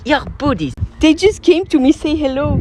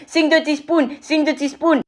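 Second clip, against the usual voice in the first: imitated fan request; 8 semitones higher than usual. Imitates fans demanding a song.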